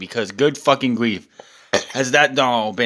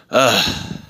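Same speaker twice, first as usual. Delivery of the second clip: low, fed-up "ugh" groan; disgusted groan; 3 semitones lower than usual. Real, not acted. Disgusted by the show.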